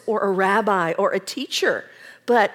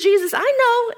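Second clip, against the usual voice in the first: high, raised protest; eager insistence; 12 semitones higher, 5 dB louder than usual. Acted. Martha cutting Jesus off with eager, high insistence. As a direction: blurt it out eagerly.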